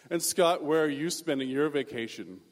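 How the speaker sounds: loud, condescending mock-snob voice; snooty classmate. Rich kid asking Scott about his vacation, snooty. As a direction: ask with pompous condescension. Snobs question the poorer kid.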